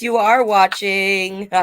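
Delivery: plain reading voice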